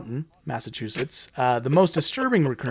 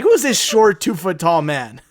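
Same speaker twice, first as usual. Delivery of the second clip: loud, high mock shock, then exasperation; mock surprised character; 6 semitones higher, 6 dB louder than usual. Mocking the story's hero, loud fake amazement. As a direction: ham up a dim hero's fake surprise. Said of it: "he's like".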